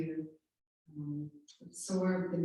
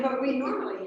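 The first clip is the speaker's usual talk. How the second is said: laughing; 7 semitones higher, 9 dB louder, much faster than usual. Real, not acted. Laughing through an awkward mix-up.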